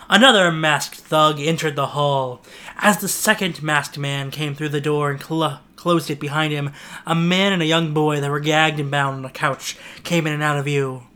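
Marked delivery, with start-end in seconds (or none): none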